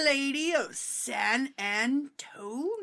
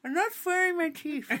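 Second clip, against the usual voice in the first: higher, toothless granny voice; 5 semitones higher than usual. Acted. Vampire without fangs mocks herself, granny voice. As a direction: talk like a granny without her teeth. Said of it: "I sound like a granny".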